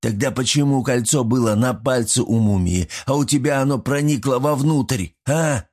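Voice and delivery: persistent challenge. A narrator's reading. Gremlun won't give up arguing with Artyom, insistent. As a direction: press the point, ending on a needling query. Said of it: "не сдавался Гремлун".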